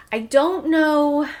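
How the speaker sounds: slow, stretched-out "I don't know"; drawn-out hesitation. Real, not acted. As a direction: drag out "know" unsure.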